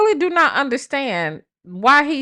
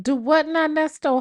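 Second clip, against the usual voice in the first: ordinary talk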